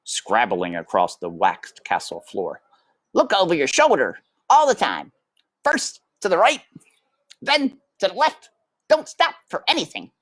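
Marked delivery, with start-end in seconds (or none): 3.1-10.1 high, fast bossy brother mouse; Furlough. A narrator's reading. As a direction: rattle off orders like a jittery brother. Furlough instructs.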